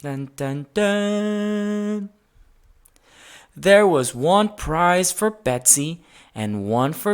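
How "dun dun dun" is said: fanfare hum. A narrator's reading. Fanfare for prizes.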